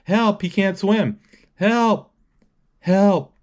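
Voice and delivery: high, panicked cries for help, slowing down; a drowning man yelling for help, voice pitched high; calling for help. Retold or imitated.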